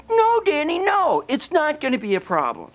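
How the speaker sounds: high, thin mocking voice; mock him in a creepy little voice. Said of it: "the same creepy little voice you just used".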